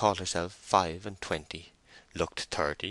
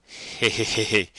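loud, effortful he-he cackle; strained, idiotic giggle; forced hideous laugh; 3 semitones higher, 6 dB louder than usual. A narrator's reading. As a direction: fake a laugh of appreciation. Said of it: "laughed the married man with a hideous effort".

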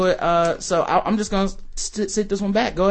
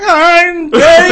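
high, slow, whiny "I'm Betty"; mock a shrill little-girl voice; squeaky girly voice; 12 semitones higher, 11 dB louder, much slower than usual.

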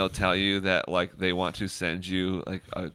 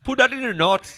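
high, loud put-on Indian accent; mock Indian scammer; 13 semitones higher, 5 dB louder than usual. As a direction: mimic an Indian scammer reading notes. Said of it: "The scammers are Indian".

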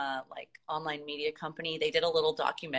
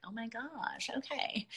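surprised; 5 semitones higher, 7 dB quieter than usual. Retold or imitated. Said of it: "I was like".

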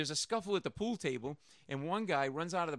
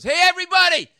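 put-on drunk shout; 17 dB louder than usual. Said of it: "He goes".

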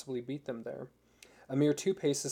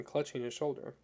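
ordinary delivery

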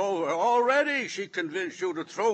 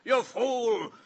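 usual speaking voice

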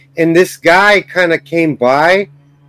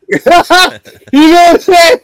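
high, slow laughing question; 8 semitones higher, 5 dB louder, slower than usual. Real, not acted. To friends, laughing hard at his own fight story.

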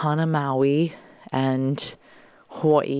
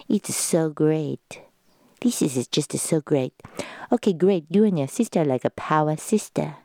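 imitating mom. Daughter lightly imitating her mom giving house instructions. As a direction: play a fussing mom with a hint of voice. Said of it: "I impersonate you guys on the pod".

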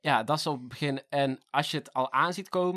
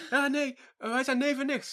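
higher mocking kid's voice; mock kids; 9 semitones higher than usual. Imitating the kids claiming they're cousins, higher mock voice. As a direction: lightly play the bickering kid. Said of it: "Ik zo".

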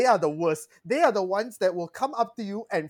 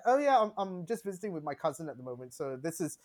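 softer, casual mock Essex; offhand, badly faked Essex accent, trailing softer; put-on Essex accent; 4 semitones lower, 8 dB quieter than usual. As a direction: explain yourself in pretend Essex. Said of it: "when I put on the accent".

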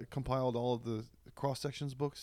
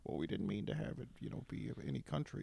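softer, unvoiced-edged mock Lucas excuse; George Lucas impression; 5 semitones lower, 8 dB quieter than usual. George Lucas excusing Jar Jar to Robin Williams, soft sheepish mock. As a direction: mumble a sheepish director's excuse. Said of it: "George is like".

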